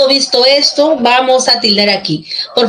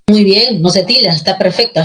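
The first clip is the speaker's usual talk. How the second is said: normal talking voice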